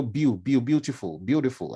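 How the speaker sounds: "Beau" said "byoo", as in beautiful. Shown as a demonstration. Speaker retelling own misreading of a name, "Beau" as "byoo". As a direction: rhyme "Beau" with "few". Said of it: "Me, I just said".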